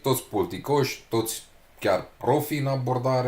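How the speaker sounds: ordinary talk, nothing marked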